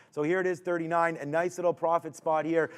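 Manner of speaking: ordinary talk, nothing marked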